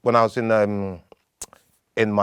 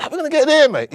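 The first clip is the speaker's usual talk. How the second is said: much higher and louder, incredulous; startled question; 6 dB louder, much faster than usual. Retold or imitated. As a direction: squeak it out in disbelief. His reply to friend's idea.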